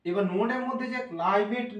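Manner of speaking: ordinary delivery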